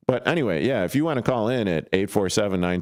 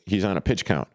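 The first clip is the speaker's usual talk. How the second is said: ordinary talk, nothing marked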